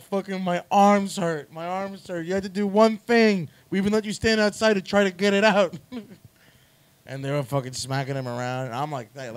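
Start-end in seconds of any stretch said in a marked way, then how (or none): 0.0-5.8 mock complaint. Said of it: "They're like".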